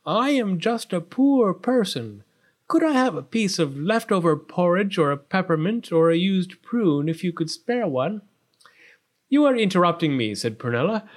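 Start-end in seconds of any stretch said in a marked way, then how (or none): 0.1-8.2 higher, beseeching wise woman; poor-beggar character voice; pleading old woman. A narrator's reading. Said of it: "said the wise woman". 9.3-10.3 quick, louder, higher rebuff; spoiled princess, annoyed; haughty princess. A narrator's reading.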